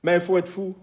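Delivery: loud playful calling out. Real, not acted. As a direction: call it out across the room. Shouting playfully across room.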